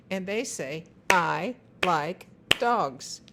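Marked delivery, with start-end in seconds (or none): 1.1-3.2 I like dogs, each word slow and loud, one clap per word; slow, clapped word by word. Shown as a demonstration. Parent showing a child how to clap each word, slow and loud. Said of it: "clap the words".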